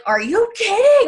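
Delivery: high, loud, outraged "are you kidding"; shocked and angry at roaches on the counter, high and loud; mad outburst. Retold or imitated. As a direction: blurt it in disgust at the roaches. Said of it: "I got so mad".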